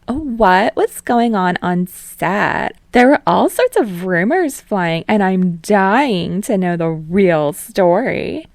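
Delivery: slow, high, loud prying; gossip-hungry host. Acted. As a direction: savor each word, dying to know.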